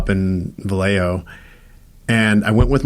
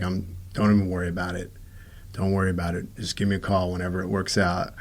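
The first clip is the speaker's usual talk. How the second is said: much softer, calm and kind; gentle reassurance; 4 semitones lower, 10 dB quieter than usual. Retold or imitated. The old man comforting the tearful teacher, softly reassuring. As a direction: soothe someone who is crying.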